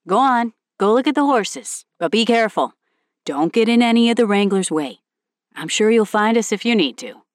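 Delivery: lightly voiced caring dad; a hint of a cautious father; fatherly advice. Tom advising daughter.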